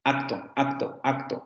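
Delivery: acto with a crisp full k before the t; careful textbook acto. Shown as a demonstration. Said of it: "se van a dar cuenta que no pronuncian acto".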